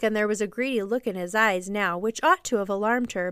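ordinary delivery, nothing marked

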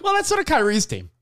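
high, put-on voice for the quote; imitating the team. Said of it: "they're like".

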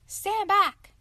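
loud, high warning shout; commanding shout. Acted. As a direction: bark it out to protect his wife. Father aiming gun at wolf.

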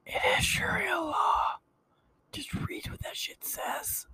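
breathy stage whisper, slow and mocking. Whispering over the clip to mock the speaker, slow stage whisper. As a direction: hiss it sarcastically under your breath.

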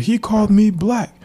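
put-on high, offended little boy; indignant kid voice. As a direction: complain to the teacher like a wronged kid. Young Nate complains to teacher.

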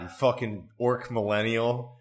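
ordinary delivery

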